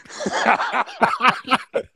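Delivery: big high burst of laughter; loud laughing fit. Real, not acted. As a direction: break up laughing hard. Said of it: "I'll say we're all laughing".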